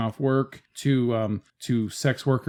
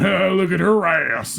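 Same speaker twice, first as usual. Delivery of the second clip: louder, higher, drawn-out leering guy; 5 semitones higher, 3 dB louder, slower than usual. Acted. Average guy slapping his buddies, leering loudly. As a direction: hoot it out like a guy ogling with buddies. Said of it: "slapping his buddies going".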